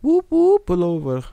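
high, loud playful whoops; mock police siren. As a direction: whoop like a siren, then order a pull-over. Jokingly imitates a police siren.

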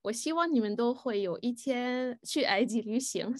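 ordinary talk, nothing marked

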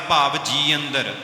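normal talking voice

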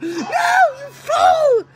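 high, loud, slow shouted rebuke; drawn-out yell. Real, not acted. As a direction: stretch out the yell at the fool. Yelling at opponent in game.

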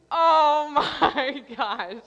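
loud, slow, drawn-out retold groan of realization; mortified disbelief. Caller learns she phoned Frontier Internet, mortified disbelief. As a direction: stretch it out high and loud, half laughing.

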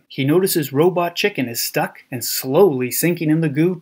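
ordinary delivery, nothing marked

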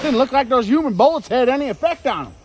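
high, loud agitated report. Acted. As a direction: blurt it out shaken. After the attack.

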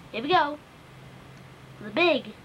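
usual speaking voice

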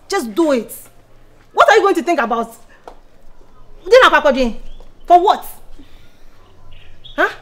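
loud, high and pushy; loud pressuring shout; heated shouting. Acted. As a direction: yell at her to just do it. Pushing her friend to abort.

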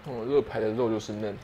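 chewing while talking; talking around food; mouth full. Real, not acted. Seen 2: bites rib at -2, lips closed chewing while talking, rib in hand.

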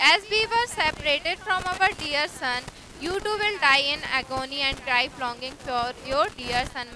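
unhurried reading of the story text; slow story reading. A narrator's reading. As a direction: read it slowly, word by word, for learners. Slow English reading aloud.

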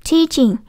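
"teaching" slow, first ee held long; long vowel. Shown as a demonstration. Teacher showing the long Y, drawn-out "teaching". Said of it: "âm y dài của đọc dài đó".

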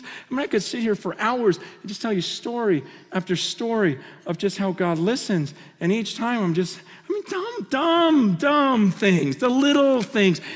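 normal talking voice

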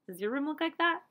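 ordinary delivery, nothing marked

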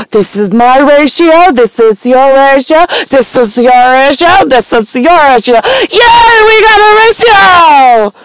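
high singsong mockery, climbing into a squeal; mocking glee. Mimicking humans pleased with their ratio, mocking glee.

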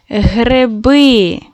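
гриби said with the first и sounding close to е; unstressed и like е. Shown as a demonstration. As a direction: say гриби with the unstressed и leaning toward е. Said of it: "чуємо ненаголошений звук «и»... як «е»". (ненаголошений и звучить як е: гриби)

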